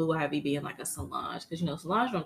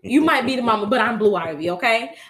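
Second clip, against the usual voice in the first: sassy Blue Ivy; 3 semitones higher, 10 dB louder than usual. Retold or imitated. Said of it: "She was like".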